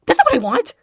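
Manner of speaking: high, loud real disbelief; shocked outburst. Real, not acted. Player seeing the invasion continue, shocked high shouting. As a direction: shout it, appalled by the outcome.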